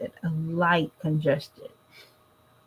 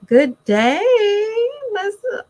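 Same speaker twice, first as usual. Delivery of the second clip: Elizabeth swooped way up high; sing-song greeting; 6 dB louder than usual. Real, not acted. Greeting a viewer by name in a singsong call. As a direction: sing out the name in a big, bright hello.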